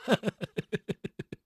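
soft suppressed laugh; can't hold back a chuckle; amused chuckle. A narrator's reading. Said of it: "他笑着对几个人说". (忍俊不禁地轻笑)

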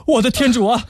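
high, urgent calls to the friends; panicked cry for help. Acted. Young man calls his friends for help as she faints, high and panicked. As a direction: shout for help, alarmed.

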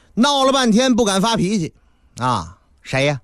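ordinary delivery, nothing marked

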